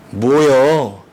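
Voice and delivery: a drawled Chungcheong 뭐요 with rising lilt; Chungcheong dialect. Retold or imitated. Preacher imitating Chungcheong speech. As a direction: say it like a slow Chungcheong local. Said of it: "충청도 사투리를 세 가지 단어로 요약".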